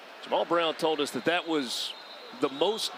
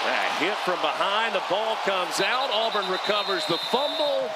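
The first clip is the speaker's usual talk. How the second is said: rising higher with each phrase; excited play-by-play; 5 semitones higher than usual. Real, not acted. Announcer calling a fumble to viewers, excited play-by-play. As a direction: call the turnover live, pitch climbing.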